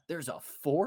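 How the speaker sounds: can't believe there's another one; surprised. Real, not acted. Hearing of a fourth Home Alone, real surprise.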